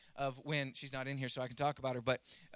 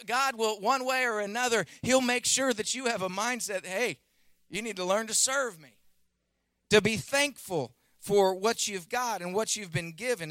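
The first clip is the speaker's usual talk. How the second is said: high, forceful sermon delivery; impassioned preaching; 6 semitones higher, 8 dB louder than usual. Real, not acted. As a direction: drive each phrase home to the congregation. Preacher drives the point home.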